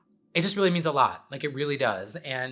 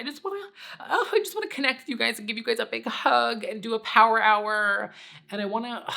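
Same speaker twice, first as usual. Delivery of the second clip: higher, swelling gush of affection; gushing emotion; 8 semitones higher than usual. Real, not acted. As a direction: spill over with longing to hug them. Emotional outpouring to viewers.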